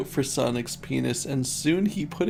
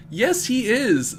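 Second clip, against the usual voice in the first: voiced story heroine; 8 semitones higher, 3 dB louder than usual. A narrator's reading. Narrator voices Amy answering principal.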